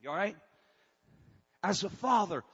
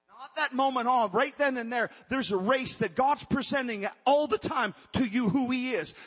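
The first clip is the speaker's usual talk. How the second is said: raised, louder preacher's push on every phrase; preaching at full force; 5 semitones higher, 6 dB louder than usual. Acted. Pastor urging his congregation, preaching at full force. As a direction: drive it home to the church, high and loud.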